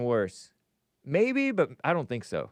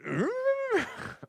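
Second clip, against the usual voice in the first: high creaky drawn-out hum; mocking little creature "mmm"; Yoda; 4 dB quieter than usual. Shown as a demonstration. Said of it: "you do a decent Yoda impression".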